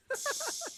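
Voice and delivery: squealing high laugh; real laugh; high giggle. Real, not acted. Burst of laughter.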